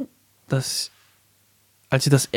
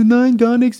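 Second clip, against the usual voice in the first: high, loud imitated protest; mock denial; 9 semitones higher, 5 dB louder, slower than usual. Girlfriend denying the affair to her boyfriend, mock protest.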